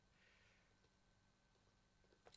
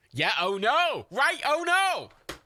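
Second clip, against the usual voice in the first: alarmed oh no; 9 semitones higher, 6 dB louder, much slower than usual. Real, not acted. Character wakes up in game.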